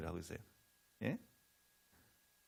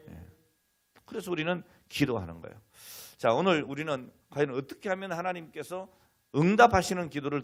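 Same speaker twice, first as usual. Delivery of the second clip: raised, forceful sermon delivery; emphatic preaching; 5 semitones higher, 8 dB louder than usual. Real, not acted. Preacher teaching congregation about answered prayer, emphatic preaching. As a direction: drive each point home to the congregation.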